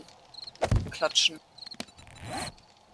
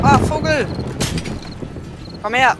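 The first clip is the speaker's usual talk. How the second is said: loud, high call at the bird; excited shout; 5 semitones higher, 12 dB louder than usual. Real, not acted. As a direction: shout to lure it in. Bird attacks in game.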